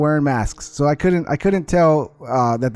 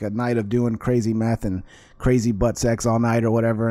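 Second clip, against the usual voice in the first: ordinary talk, nothing marked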